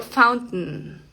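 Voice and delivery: "fountain" with the T stopped in the throat; glottal T. Shown as a demonstration. Teacher giving an example word, glottal T. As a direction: end "fountain" on a nasal n, T unreleased. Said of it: "Esa es una T glotal".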